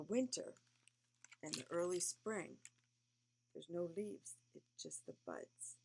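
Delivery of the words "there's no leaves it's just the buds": ordinary delivery, nothing marked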